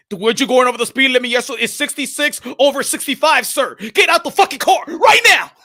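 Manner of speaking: mock furious cop at a traffic stop; angry cop. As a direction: bark orders like a power-tripping cop. Cop ordering him out.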